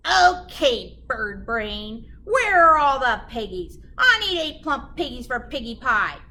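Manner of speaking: loud, demanding witch. Acted. Gritch demanding pigs from the cow, loud and bossy. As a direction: bark it out like a hungry witch.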